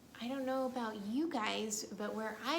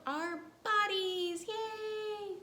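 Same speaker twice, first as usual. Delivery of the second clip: high and loud cheer; excited cheer; 9 semitones higher, 4 dB louder, much slower than usual. Real, not acted.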